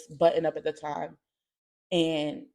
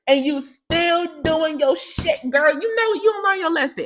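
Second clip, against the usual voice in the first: scolding; 9 semitones higher, 5 dB louder, slower than usual. Real, not acted. Scolding her mother.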